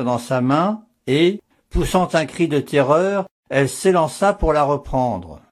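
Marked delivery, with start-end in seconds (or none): none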